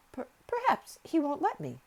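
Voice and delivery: high, timid stammer; hesitant, half afraid. A narrator's reading. Nurse afraid to approach the boy, hesitant. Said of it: "she hesitated in a low voice".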